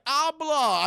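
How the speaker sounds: loud, high attempt at broken Spanish; mock broken Spanish. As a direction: fumble out Spanish like a non-speaker. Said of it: "starts speaking really broken Spanish".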